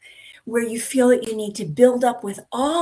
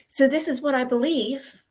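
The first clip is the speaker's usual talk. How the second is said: statement turned uncertain, "believe" left hanging up; hesitant up note on believe; 4 semitones higher than usual. Shown as a demonstration. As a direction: end on "believe" as if asking. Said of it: "a little bit of hesitation there".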